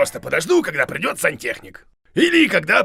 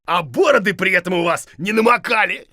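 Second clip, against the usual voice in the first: normal talking voice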